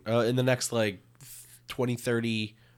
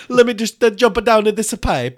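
high, loud goofy game voice; cartoon game hero voice; 10 semitones higher, 7 dB louder than usual. Friend goofing as a video game plumber, high and loud.

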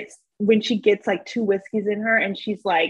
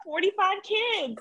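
mock exaggeration; 11 semitones higher, 5 dB quieter than usual. Exaggerated joke about Keke's kids.

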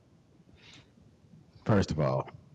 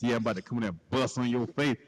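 normal talking voice

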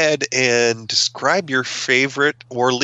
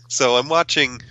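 normal talking voice

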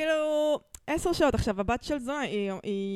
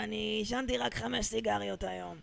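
softer, lightly put-on voice of a chain-smoking woman; Gila's voice; 7 dB quieter than usual. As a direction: say it like the smoker Gila, quieter. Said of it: "שהיא מדברת ככה". (הקול של גילה, רך ומעושן קלות)

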